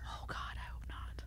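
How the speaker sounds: hushed worried whisper. Real, not acted. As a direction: whisper it under breath. Joking reply.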